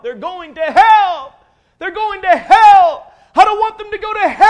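reliving an agonized cry, pitched high and loud; anguished crying out. Retold or imitated. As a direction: wail it out like a man breaking over lost souls. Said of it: "I just began to cry out".

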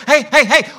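the women bursting in to tell the disciples, breathless; breathless excitement. Acted. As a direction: burst in with the big news.

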